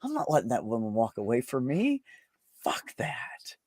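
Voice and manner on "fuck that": soft, voiceless dismissal; emphatic whisper. Real, not acted. Refusing to let that woman walk away, whispered.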